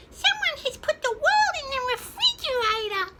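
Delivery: very high, loud chick voice; peeping chick. A narrator's reading. Smallest chicken feels the cold wind, high peep. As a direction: squeak it out in alarm. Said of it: "peeped the smallest chicken".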